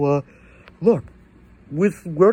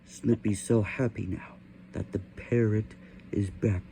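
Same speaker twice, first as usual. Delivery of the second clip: softer, fading closing narration; quiet story narration; 9 semitones lower, 12 dB quieter than usual. A narrator's reading. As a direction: wind the tale down gently.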